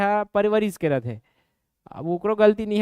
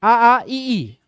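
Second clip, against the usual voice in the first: loud, forceful आ and ई; said loudly; 4 dB louder, much slower than usual. Shown as a demonstration. Teacher telling students to say the vowels loudly. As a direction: belt out आ, आ, ई so the tongue move is felt. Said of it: "जोर से बोलोगे समझ आएगा".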